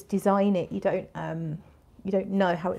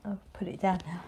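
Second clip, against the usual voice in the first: slightly breathless, quiet; out of breath; 10 dB quieter, faster than usual. Real, not acted. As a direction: talk while a bit winded. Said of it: "I don't know why I'm out of breath".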